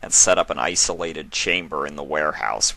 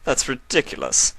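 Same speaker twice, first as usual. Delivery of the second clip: scoffing; 8 semitones higher than usual. A narrator's reading. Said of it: "the stallion scoffed".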